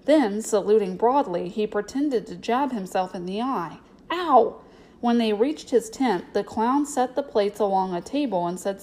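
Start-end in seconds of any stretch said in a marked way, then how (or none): 4.1-4.6 high, loud comic "ow"; mock pained yelp. A narrator's reading. Clown pretends to jab his own eye, mock yelp. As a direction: yelp as if poked, hammed up.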